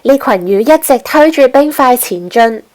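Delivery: ordinary delivery, nothing marked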